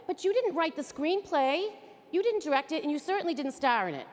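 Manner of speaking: ordinary talk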